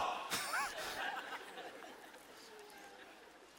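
breathy unvoiced laugh; real wheezing laugh. Real, not acted. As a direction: dissolve into silent laughter. Laughing at own story.

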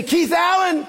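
high-pitched mom calling out the full name; serious scolding mother. Retold or imitated. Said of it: "I knew it was serious".

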